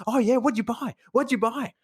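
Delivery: put-on high, excited questioning kid voice; eager teen. Teen quizzes shopper about groceries, high and eager. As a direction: pester him about his shopping. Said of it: "He's like".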